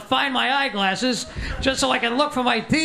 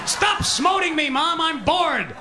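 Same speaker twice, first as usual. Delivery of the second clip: put-on high, slow whine of a child; whiny bored kid; 6 semitones higher, slower than usual. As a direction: kid complaining to mom in church. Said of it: "you're nine years old".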